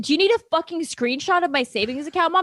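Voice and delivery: a touch of furious sarcasm; retold anger. Daughter retelling her rant at mom, mildly heated. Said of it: "I said".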